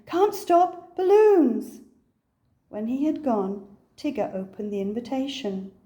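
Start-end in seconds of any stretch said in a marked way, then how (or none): 0.1-1.8 high, loud call while dashing off; no time to stop; racing out the door; hurried shout. Acted. Rushing off to buy balloons.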